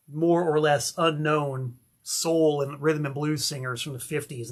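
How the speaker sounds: ordinary talk, nothing marked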